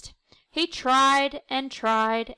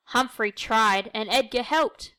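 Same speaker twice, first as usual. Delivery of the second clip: ordinary delivery, nothing marked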